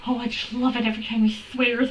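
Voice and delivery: ordinary talk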